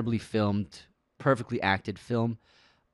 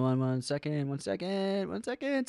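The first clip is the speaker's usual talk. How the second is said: soft, each repeat climbing higher; stalling; 3 semitones higher, 7 dB quieter than usual. Real, not acted. Host stalling to find a comment, soft rising pitch. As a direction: let it creep up into a small high voice.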